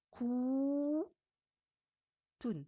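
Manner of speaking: "ku" held long and high, then "tun"; long vowel. Shown as a demonstration. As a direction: sustain "ku" before a short "tun". Teacher sounds out word by syllables.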